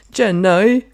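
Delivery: put-on loud, slow silly accented drawl; a bad cross of Borat and a simple Southerner; Forrest Gump. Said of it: "you're like a Borat Forrest Gump".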